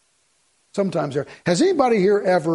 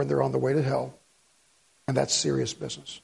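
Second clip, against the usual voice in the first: low, much softer and grave; solemn; 7 semitones lower, 7 dB quieter than usual. Real, not acted. Preacher warning the church about the lost, grave and soft. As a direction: drop the voice for weight.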